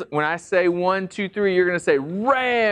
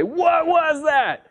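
startled parent; 12 semitones higher, slower than usual. Retold or imitated. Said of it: "your mom and dad say".

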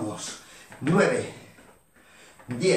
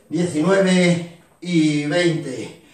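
drawn-out final count; 4 dB louder, much slower than usual. Shown as a demonstration. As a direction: stretch the last numbers out slowly, louder. Coach drags out final count.

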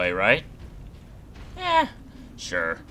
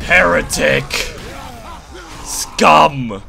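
battle shout; 8 semitones higher, 11 dB louder, much slower than usual. Pretend. Shouting at enemies in game.

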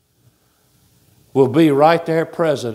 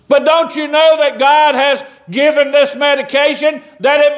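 fired-up preaching; 12 semitones higher, 5 dB louder than usual. Acted. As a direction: preach it out to the congregation. Preaching rises again, higher and louder.

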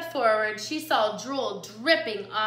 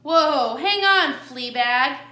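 loud, raised protest; calling a halt; 3 semitones higher, 9 dB louder, slower than usual. A narrator's reading. Jilly stops the coyote short, loud and high. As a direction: cut in loudly to stop them.

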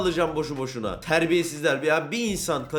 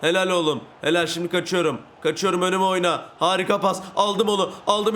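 ordinary talk, nothing marked

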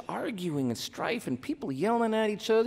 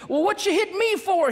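high-pitched indignant cry from the husband; cried out; 13 semitones higher, 3 dB louder than usual. Acted. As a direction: yelp it out much higher, stung. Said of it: "he cried out".

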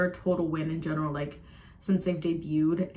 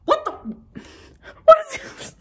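much higher, loud startled shriek; panicked shriek; 8 dB louder than usual. Real, not acted. Startled by scary scene.

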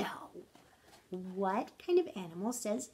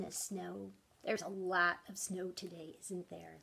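whisper; 4 semitones lower, 9 dB quieter than usual. Real, not acted. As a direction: whisper it like a secret. Whispered aside to kids.

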